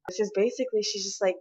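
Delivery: normal talking voice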